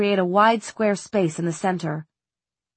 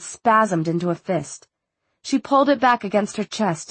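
ordinary delivery

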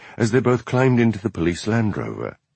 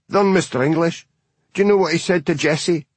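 higher, quicker busybody voice; indignant old spinster; 8 semitones higher, faster than usual. Acted. Spinster twin telling the constable, a fast high scandalised voice. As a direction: rattle it off, scandalised.

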